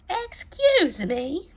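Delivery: slow, high, loud polite throat-clear; a small cricket coughing for attention; cricket's cough. A narrator's reading. Cricket interrupting Gerald, a polite high cough. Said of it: "coughed a cricket".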